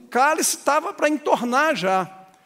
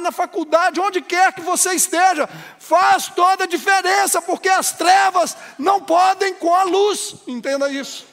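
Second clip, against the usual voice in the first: higher, louder fiery sermon voice; full-force preaching; 8 semitones higher, 6 dB louder than usual. Acted. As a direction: preach it out with rising fervor. (pregação inflamada, mais alta e forte)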